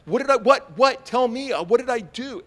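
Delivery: high, loud panicked babble; retold panic at full pitch; acted frantic plea. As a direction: beg desperately to be told. Acts out frantic plea to cop.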